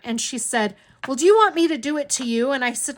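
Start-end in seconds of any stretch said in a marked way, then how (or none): 1.1-2.5 louder, higher, rapid mock retort; imitated cross challenge, quick and loud; retold anger. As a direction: fire back like an indignant aunt. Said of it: "she was just mad".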